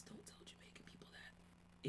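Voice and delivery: hushed conspiratorial whisper. Real, not acted. Friend whispering a warning about Jamaican people. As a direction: drop to a secret whisper.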